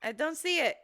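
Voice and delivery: high, loud mock helplessness; mocking imitation. Woman mimicking friend who searches badly, mocking high voice. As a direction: tease with an exaggerated clueless searcher. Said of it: "you're the worst person at searching".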